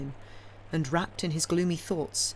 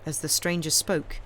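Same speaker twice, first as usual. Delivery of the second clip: usual speaking voice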